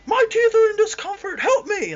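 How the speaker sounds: high, loud mock cry for help; acted inner scream. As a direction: scream a desperate plea, playing it up. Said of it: "I'm screaming that in my mind".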